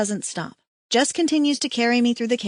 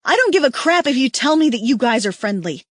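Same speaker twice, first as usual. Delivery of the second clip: loud, higher angry tirade; furious rant; 4 semitones higher, 5 dB louder than usual. A narrator's reading. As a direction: rage at them, demanding action. Stranger woman rants angrily.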